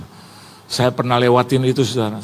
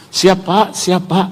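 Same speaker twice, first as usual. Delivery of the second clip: put-on teasing, higher-pitched young man; mocking; 7 semitones higher, much slower than usual. Young climber taunts the pastor before the climb, mocking. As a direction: imitate someone goading you with a raised voice. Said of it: "Dia kayak ngeledek saya".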